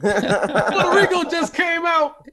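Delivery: high, stretched-out mock headline cry; mock breaking-news announcer. Teasing Puerto Rico about coming out, mock announcer cry. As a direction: announce it like a scandalous exclusive.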